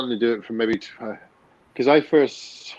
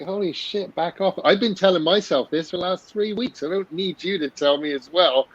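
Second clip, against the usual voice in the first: higher-pitched, fed-up talking back; exasperated retort; 7 semitones higher than usual. Retold or imitated. Patient answering back to the scolding medicine, exasperated. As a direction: protest as if fending off a nag.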